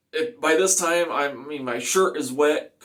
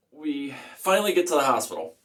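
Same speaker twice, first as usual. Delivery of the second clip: ordinary talk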